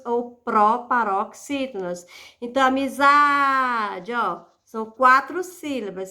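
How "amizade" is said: "amizade" with a very long "za"; stress on penultimate syllable. Shown as a demonstration. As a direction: stretch out the "za" in "amizade". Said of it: "Sílaba tônica é a penúltima". ("amizade" alongando bastante o "za")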